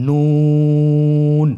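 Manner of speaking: নুন stretched very long, thin and loud; drawn-out letter name. Shown as a demonstration. Teacher introducing the next letter, long drawn-out নুন. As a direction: hold নুন for three counts. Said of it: "জিহ্বার আগাটা ... আমার সাথে".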